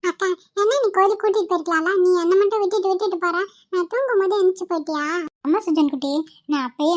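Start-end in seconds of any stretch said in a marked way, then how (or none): 0.0-5.0 put-on higher little-boy voice, complaining; a small boy sulking at being left behind; sulky child.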